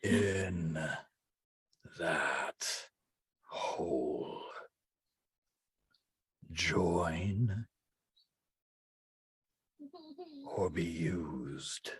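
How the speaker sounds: low, slow menacing whisper. Acted. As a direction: hiss it like an alien lord. GM voices the insect lord.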